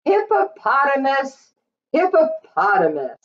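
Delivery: ordinary delivery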